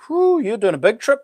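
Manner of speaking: normal talking voice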